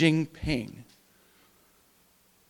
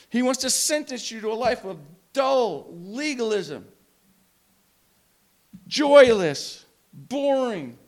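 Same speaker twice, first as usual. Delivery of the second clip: rising, louder as he piles on the complaints; mock indignant build; 5 semitones higher, 7 dB louder than usual. Preacher voicing what people think of a harsh God, building scorn. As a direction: hammer each grim word harder.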